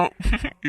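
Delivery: high villainous snicker; mocking chuckle. Captor taunting the woman he tricked, mocking chuckle. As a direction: laugh at her for being fooled.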